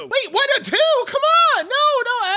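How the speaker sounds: high loud indignant cry; outraged protest. Acted. Outraged at score of two.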